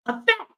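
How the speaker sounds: crisp hard final T on fact; stress on the T. Shown as a demonstration. Telling a chat commenter, fact with a hit final T. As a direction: snap the T at the end of fact. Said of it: "Emphasis on the T".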